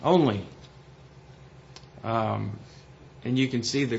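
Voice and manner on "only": Only said alone, louder with a falling close; Only spoken on its own, loud and final; stress on Only. Shown as a demonstration. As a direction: lean hard on the single word Only. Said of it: "he starts off with the word only".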